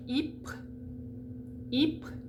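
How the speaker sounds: Ypres said "eep". Shown as a demonstration. Teacher showing French Y, said as E. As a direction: say "Ypres" with a pure "ee" and short ending. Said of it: "pronunciation of E is when you see".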